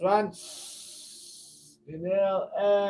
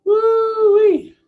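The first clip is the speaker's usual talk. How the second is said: euphoric whoop; 12 dB louder than usual. Real, not acted. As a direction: release it all with a cry. Euphoric release after breath hold.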